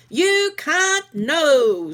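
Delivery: high, slow, loud squawking parrot; a shrill-free squawk of a posh bird; loud British parrot. A narrator's reading. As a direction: stretch it out like a proud parrot in a British accent. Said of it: "said the parrot".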